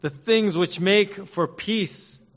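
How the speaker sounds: high, slow, aching plea; Jesus lamenting. Acted. Jesus weeping over Jerusalem, pleading with the city, high and slow. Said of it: "Look what he says in verse forty-two".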